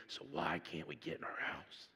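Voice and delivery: breathy whispered question; whispered plea. Retold or imitated. Pastor whispering his question to God, pleading. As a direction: whisper it, worn out.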